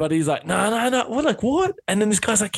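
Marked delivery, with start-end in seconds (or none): 0.5-1.7 high-pitched retold denial, rising to "what"; mock bewilderment. Retelling a mate asked about a stitch-up, mock bewildered. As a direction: act out an innocent, climbing higher. Imitating a man playing innocent when questioned.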